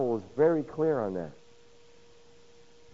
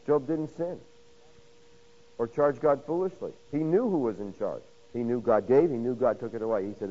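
ordinary talk

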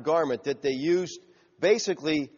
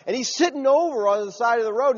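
normal talking voice

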